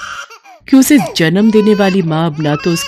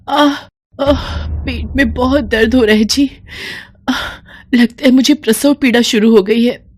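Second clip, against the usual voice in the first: higher, pained moans; a touch of labour pain; moaning in labour; 6 semitones higher than usual. Acted. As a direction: groan through labour pains. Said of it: "वो कराहते हुए".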